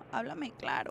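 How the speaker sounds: breathe, then say it softly; quiet, contained exasperation; restrained plea. Retold or imitated. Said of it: "respiro y digo".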